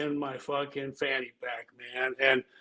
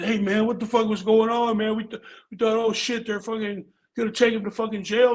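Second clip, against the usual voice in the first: raised, worked-up retelling of the guys' panic; mock alarmed friends; 6 semitones higher than usual. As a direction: play the worried buddies, pitched up high.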